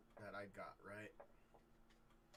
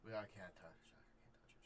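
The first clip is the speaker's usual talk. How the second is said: whispered refusal; 7 dB quieter than usual. Acted. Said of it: "They're like".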